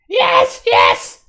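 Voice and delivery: loud, squeaky creature voice; cowed kobold. Acted. Kobold agreeing to the dwarf's orders, cowed squeal. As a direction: yelp it in hurried submission.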